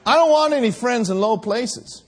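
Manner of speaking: loud, high shouted preaching; preaching at full force. Acted. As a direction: build to a roar of praise.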